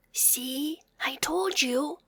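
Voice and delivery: soft whisper. Acted. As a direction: whisper an I-told-you-so. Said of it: "whispered the tiny bear".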